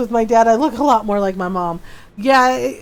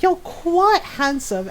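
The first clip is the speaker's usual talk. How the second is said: slow, higher-pitched mock admiration; imitated flirting; 5 semitones higher, slower than usual. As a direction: draw it out like a smitten friend. Friend flirting with dad, imitated.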